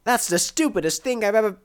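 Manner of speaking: high-pitched indignant tirade, cut off; outraged rant. Acted. Quarrelling over a useless bomb, outraged rant. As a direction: rant in high, scornful disbelief.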